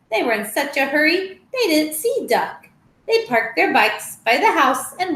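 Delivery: lively storybook read-aloud with voiced animals; reading to children. Teacher reading a picture book to children, lively. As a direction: tell the tale brightly to little listeners.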